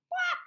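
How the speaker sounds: a very high, loud whop sound effect; sudden whop. Acted. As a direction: burst out with a big comic whop. Sound effect of dog through page.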